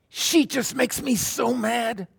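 high-pitched, drawn-out angry venting; acted exasperation. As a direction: act fed up to bursting, voice way up. Acts out someone venting anger.

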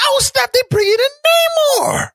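high-pitched re-enacted thrill; retold excitement. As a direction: squeal out the remembered trailer reaction. Retelling reaction to the trailer.

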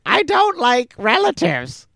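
squeaky grumpy pappy; pappy grump. Retold or imitated. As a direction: sniff at the relatives from way up high. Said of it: "he seriously goes".

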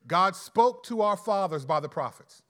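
loud, measured Bible reading to the congregation; scripture reading. Acted. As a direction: read the verses out with weight. Reads scripture passage aloud.

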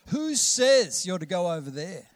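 acted indignant retort; indignant challenge. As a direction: challenge someone bossy, leaning on "says". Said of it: "when we say to Harry".

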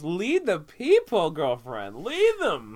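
hammed-up call to lead the people; mock exhortation. Teasing co-host as new Moses, mock-rousing cry.

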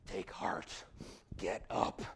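soft unvoiced whisper. Crowd whispering encouragement to the blind man. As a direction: breathe the words gently.

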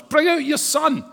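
high, loud demanding cry; crowd demand. Retold or imitated. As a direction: yell it like an angry mob.

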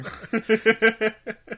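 loud high burst of laughter; real laugh, high and loud. Real, not acted. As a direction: crack up loudly. Big laugh at joke.

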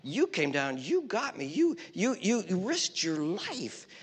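soft, halting thanks; humble gratitude. Acted. Half-dead man to the guy who saved him, humble gratitude. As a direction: thank your rescuer quietly, stumbling over the words. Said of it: "turn to the guy who saved him and he'd say".

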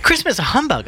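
high, bright nephew voice; nephew's voice. Acted. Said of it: "said Scrooge's nephew".

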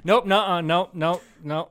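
loud, high nope nope nope; mock loud refusal; emphatic refusal. Mimicking her refusal, loud.

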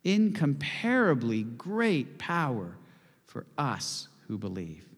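slow recital; slow, weighty repeat. Shown as a demonstration. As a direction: draw each word out. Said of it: "One more time".

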